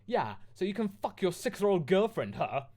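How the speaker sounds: sarcastic goth shouting at Lupin. Acted. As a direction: sneer it out loud. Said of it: "I shouted sarcastically".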